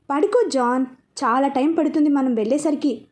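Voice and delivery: ordinary delivery, nothing marked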